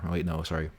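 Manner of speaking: ordinary talk, nothing marked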